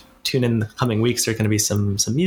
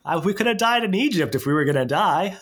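mock whiny Israelites; 8 semitones higher than usual. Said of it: "the Israelites say to Moses".

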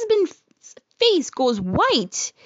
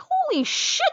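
pained yelp. Retold or imitated. Exam finger hurts, she yelps.

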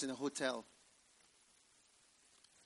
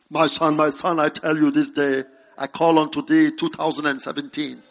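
grand divine voice, lowered and loud; imagined voice of God; 19 dB louder than usual. Acted. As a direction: boom it out like a mock-solemn God. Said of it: "You may never hear it".

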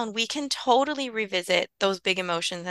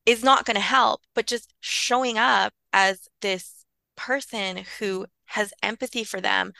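normal talking voice

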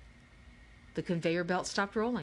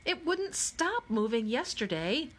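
plain reading voice

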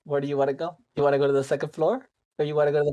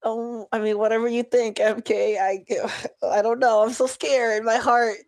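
higher, breathless mock fright in character; mock panic; 7 semitones higher than usual. Scared protege tells the party, higher panicky voice. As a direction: play the scared protege, heart racing.

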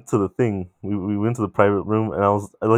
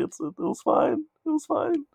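much higher, softer, slow strained fine; unconvincing fine; 6 dB quieter than usual. Retold or imitated. [name] telling his cousins it was fine, squeaky and high. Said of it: "I was like".

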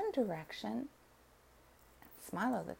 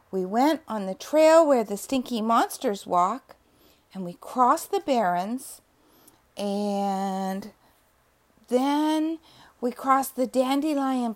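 louder, earnest little cub; eager lion cub; 9 dB louder than usual. A narrator's reading. Lion cub explains to the dog, loud and eager. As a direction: tell it all bravely but tired.

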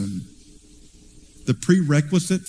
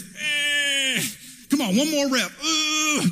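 grunting like a lifter on a last rep; effortful gym grunt, pitched high; straining branch; 9 semitones higher, slower than usual. Pretend. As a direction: strain out the words like lifting heavy.

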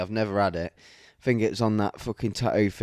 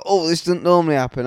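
fast, mock-innocent client voice; hamming up the excuse; imitating the woman; 5 semitones higher, 5 dB louder, faster than usual. Tattooist mimicking a client's excuse, quick and mock-innocent. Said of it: "and she's like".

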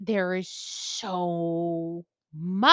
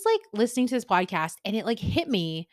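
ordinary talk

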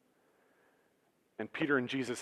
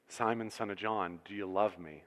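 quiet, tender question; 4 semitones lower, 8 dB quieter than usual. Acted. Jesus asks Peter tensely.

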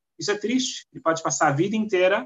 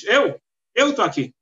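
"Ich" alto e agudo, cheio de si; cheio de ganância; 6 semitones higher, 5 dB louder than usual. Retold or imitated. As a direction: responda orgulhoso, se achando o grande justo. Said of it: "com toda a ganância dele". (homem arrogante responde à porta com ganância)

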